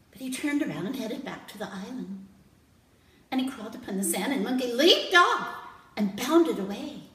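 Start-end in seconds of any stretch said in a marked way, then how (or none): none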